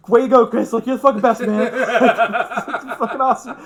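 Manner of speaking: high, loud cheering through real laughter; cracking up while congratulating; laughing cheer. Real, not acted.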